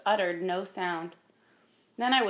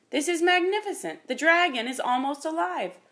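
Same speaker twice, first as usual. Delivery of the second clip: high, loud marvelling; thrilled, raised-pitch admiration; excited awe; 8 semitones higher, 5 dB louder than usual. A narrator's reading. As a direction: exclaim in wonder at a masterpiece. Apprentice marvels at the painting.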